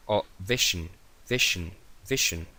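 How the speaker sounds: "vision, vision" modelled for students; model pronunciation. Shown as a demonstration. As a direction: repeat "vision" clearly. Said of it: "cómo se pronuncia".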